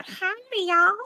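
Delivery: high-pitched, hammed-up Southern accent; badly faked Southern twang; atrocious Southern accent. Shown as a demonstration. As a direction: put on a bad Southern accent. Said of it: "my Southern accent's so atrocious".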